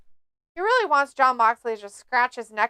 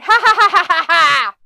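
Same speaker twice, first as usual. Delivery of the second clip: loud, high fake cackle; mock evil laugh; 4 semitones higher, 6 dB louder than usual. Wrestler laughing at his opponent, loud mock cackle. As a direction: cackle like a cartoon villain. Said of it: "It was like".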